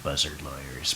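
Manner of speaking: quietly savoring Dreiser's phrase. Shown as a demonstration. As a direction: murmur buzzard lawyers again, enjoying it. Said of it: "I like that".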